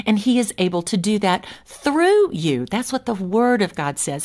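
ordinary talk, nothing marked

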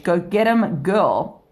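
ordinary talk, nothing marked

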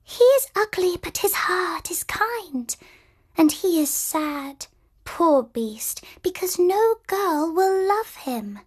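high whispery bird voices; twittering birds. Acted. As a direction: chirp it like tiny birds. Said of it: "the birds twittered back".

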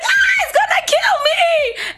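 high, loud shriek of pretend terror; mock terrified scream. Imagining the reaction to the word, shrieking in mock terror. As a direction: shriek like the word will kill you. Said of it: "we'd be like".

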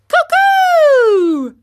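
very high, shouted cuckoo; mocking. A narrator's reading. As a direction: shriek the bird call to taunt them. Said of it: "mocking them".

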